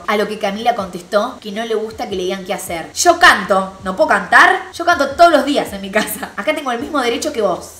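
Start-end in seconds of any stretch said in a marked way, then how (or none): 2.9-7.7 loud, high, acted indignation; defiant protest. Camila defending her right to sing, imitated defiant protest. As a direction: argue back, pitched up and insistent. Acted quarrel over singing.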